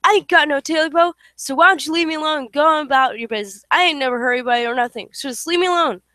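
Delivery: loud desperate yell; yelling back. Acted. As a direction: shout as loud as you can at the thing. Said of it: "yelled back as loud as he could".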